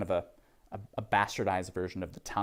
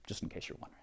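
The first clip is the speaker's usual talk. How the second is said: whispered aside; 5 semitones lower, 16 dB quieter, much faster than usual. Real, not acted. Aside to the class.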